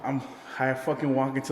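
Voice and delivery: ordinary talk, nothing marked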